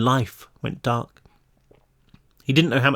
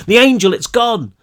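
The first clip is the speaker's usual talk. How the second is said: desperate yell, louder and higher; panicked shout; 9 semitones higher, 6 dB louder than usual. Acted. Dazed boy shouting to the running figures, panicked yell. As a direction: cry it out to the figures running at you.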